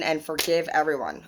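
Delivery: usual speaking voice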